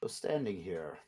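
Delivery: cold noble lord, menacing. Acted. GM voices lord character.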